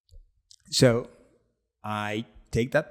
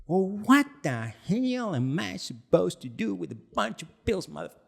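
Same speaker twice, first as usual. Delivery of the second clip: mock angry rant; 7 semitones higher than usual. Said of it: "he goes off on me".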